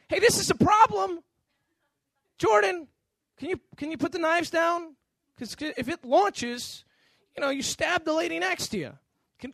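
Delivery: high, loud plea to put the knives down. Retold or imitated. A dad warning his son at dinner, loud and high.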